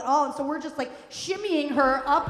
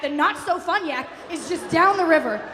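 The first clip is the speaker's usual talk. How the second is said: normal talking voice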